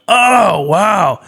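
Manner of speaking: hammed-up surprise; mock amazement. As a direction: act blown away. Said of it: "and you're like".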